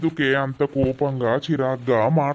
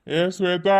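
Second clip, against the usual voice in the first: calling out; 5 semitones higher than usual. Acted. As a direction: call Shweta across the house. Calls again, louder.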